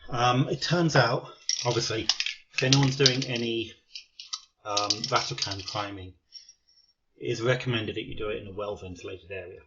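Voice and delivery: panting after running up the stairs; breathless, catching your breath between words. Real, not acted. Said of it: "hence the reason why I'm out of breath".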